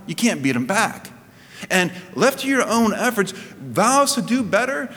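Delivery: high, fervent preaching voice. Real, not acted. Pastor urging the congregation to strive, fervent preaching. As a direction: preach it out with rising fervour.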